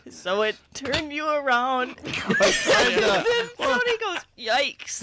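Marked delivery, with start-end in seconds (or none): 2.0-5.0 words broken by laughter; laughing. Real, not acted. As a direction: laugh through the line. Retelling through laughter.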